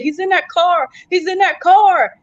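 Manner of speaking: high, urgent re-enacted warning; retold alarm. Fleeing woman warns shelter worker of pursuer, retold alarm. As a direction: raise the pitch as if pointing out danger.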